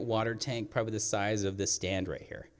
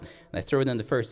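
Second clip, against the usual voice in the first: ordinary talk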